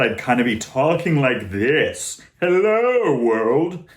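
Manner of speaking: big, hollow operatic talking voice; far-back operatic speech. Shown as a demonstration. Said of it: "It's just deeper and bigger".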